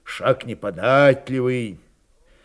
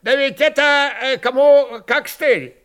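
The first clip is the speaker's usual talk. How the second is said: high-pitched, louder sly jibe; snide; 11 semitones higher, 6 dB louder than usual. A narrator's reading. Kondrat needles the old man beside him, snide. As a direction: needle him with mock hesitation. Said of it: "ехидно заметил Кондрат".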